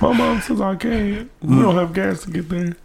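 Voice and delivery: a hint of a sad little kid; small kid voice. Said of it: "It's like".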